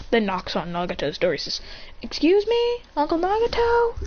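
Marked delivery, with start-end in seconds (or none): 2.0-3.9 very high, sing-out question; polite calling out. Retold or imitated. Nephew knocking on Uncle Nagato's door, high polite call. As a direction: call through the door, pitched way up.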